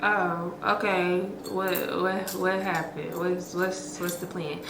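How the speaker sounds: quietly pressing for the plan; hushed curiosity on the phone to her friend; hushed eager asking. Retold or imitated.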